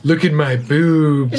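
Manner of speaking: slow laughing flirty voice; sultry woman voice. Pretend. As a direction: ham up the hot chick. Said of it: "That's like a hot chick".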